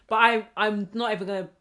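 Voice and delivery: ordinary delivery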